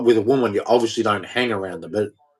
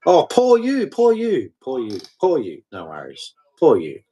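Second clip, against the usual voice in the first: mock pity; 3 semitones higher than usual. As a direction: fake sympathy, mocking their whining. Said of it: "you're sitting there going".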